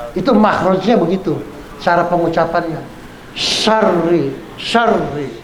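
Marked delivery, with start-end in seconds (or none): 3.3-5.3 "Syarri" with strong sh and long rolled r; doubled r. Shown as a demonstration. Teacher modelling the tasdid, held r in "syarri". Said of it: "Ro-nya tasdid".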